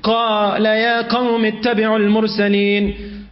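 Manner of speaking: fast chanted Quranic recitation. Acted. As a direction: recite it in a quick tajweed chant. Quotes Surah Yasin.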